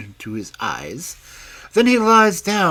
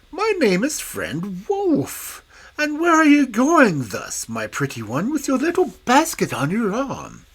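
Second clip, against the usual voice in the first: put-on a wolf charming the little girl; big bad wolf sweetly flattering the girl; sly wolf; 9 semitones higher than usual. Wolf character voice.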